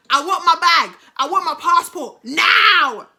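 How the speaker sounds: slow, high shout building on the last word; acted furious demand. Woman at his door for her passport, shouted demand. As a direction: shout the demand, stretching the end.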